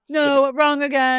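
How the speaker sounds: high, slow game show reply; mock phone voice. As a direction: play the cheerful answerer. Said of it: "Wendy's reply in skit".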